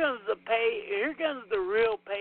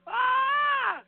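high yelled "Ah!"; mock scream; 14 semitones higher, 4 dB louder than usual. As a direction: let out a sudden high cry. Mimicking scream at film.

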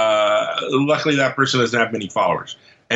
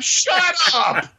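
annoyed shout. Retold or imitated. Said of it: "you're like".

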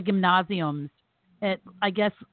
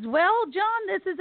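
dad stalling; 11 semitones higher, slower than usual. Retold or imitated. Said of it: "he goes".

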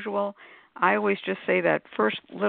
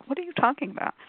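puzzled listener; 6 semitones higher, faster than usual. Retold or imitated. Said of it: "there's gonna be people going".

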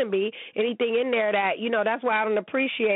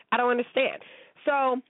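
normal talking voice

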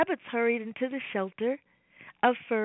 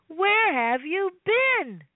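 calling mother; 6 semitones higher, 4 dB louder, slower than usual. Acted. Worried mommy calls out.

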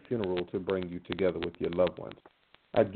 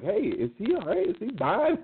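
much higher, alarmed mock concern; mock worried siblings; 12 semitones higher than usual. Siblings phoning each other in mock alarm, high worried voice. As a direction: play the panicked sibling checking in. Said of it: "they started calling each other, like".

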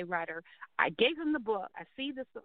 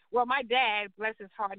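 usual speaking voice

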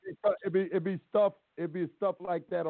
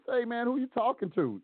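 high, challenging retort; defiant comeback; 8 semitones higher than usual. Retold or imitated. Talking back to the judge who hollered at him. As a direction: push back hard at the judge. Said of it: "That's exactly what I said to him".

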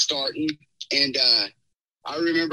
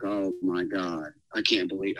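retold dismay; 11 semitones lower, 5 dB quieter than usual. Boyfriend hearing girlfriend cry on speaker, quietly stunned. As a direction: let it drop soft and low, stunned. Recalls being overheard, horrified.

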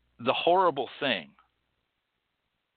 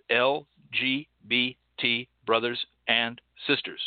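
ordinary delivery

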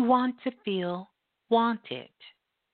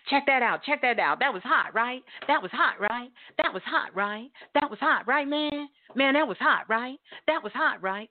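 needy bragging; 3 semitones higher than usual. Acted. Guy fishing for validation from his friends, needy bragging. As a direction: keep nudging for a yes with every "right?". Mimicking men fishing for approval.

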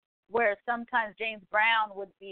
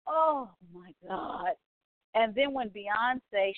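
ordinary talk

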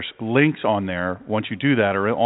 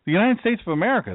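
pitched up, fast and emphatic; emphatic exclaim; 10 semitones higher, faster than usual. Real, not acted. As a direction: announce it with a rising burst. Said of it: "You're asking me what country?".

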